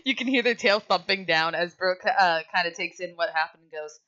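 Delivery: ordinary talk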